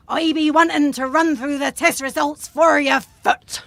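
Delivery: doctor putting on pirate talk. Acted. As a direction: deliver it in a reluctant pirate accent. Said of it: "you're talking my language".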